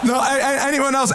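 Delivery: ordinary talk, nothing marked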